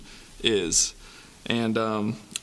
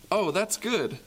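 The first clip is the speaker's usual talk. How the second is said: cheerful refrain; 7 semitones higher, slower than usual. A narrator's reading.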